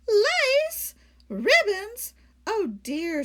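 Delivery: high, loud stunned questions; shocked disbelief. Acted. Lady finding lace on the steak, high loud shock. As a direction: gasp each word in disbelief.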